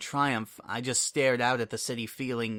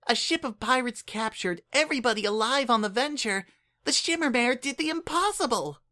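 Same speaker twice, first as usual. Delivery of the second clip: put-on much higher, earnest little changeling kid; Kukri's voice; 12 semitones higher than usual. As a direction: speak up like an eager, caring child. Kukri speaks.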